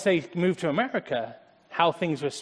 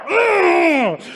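loud, high groan of frustration; frustrated groan; 13 semitones higher, 10 dB louder than usual. Retold or imitated. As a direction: groan at yourself. Groaning at own slip.

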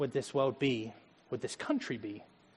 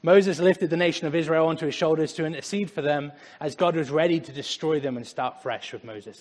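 usual speaking voice

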